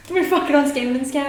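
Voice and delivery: high, fast gleeful cry; excited. Real, not acted. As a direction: crow it out in triumph. Gleeful shout of triumph.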